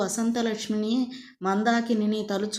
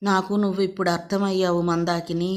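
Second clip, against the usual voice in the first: ordinary delivery, nothing marked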